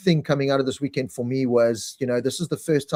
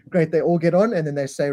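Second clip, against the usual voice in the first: ordinary talk, nothing marked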